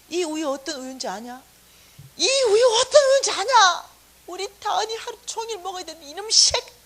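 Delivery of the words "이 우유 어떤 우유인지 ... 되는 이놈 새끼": high, full-volume enraged yell; acted screaming tirade; furious scolding. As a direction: explode at the child, loud and high.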